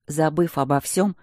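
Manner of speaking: plain reading voice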